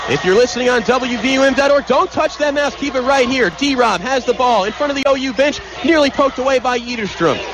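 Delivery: normal talking voice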